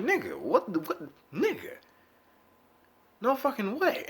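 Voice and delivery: high, incredulous cry; retold disbelief. Seeing the damage, high incredulous disbelief. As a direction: refuse to believe it. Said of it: "I'm like".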